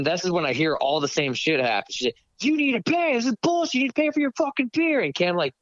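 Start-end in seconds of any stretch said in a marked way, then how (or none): 2.4-5.0 very high mock demanding voice; high-pitched put-on harangue; imitating irate bartender. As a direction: mimic her tirade way up high. Acting out bartender demanding payment.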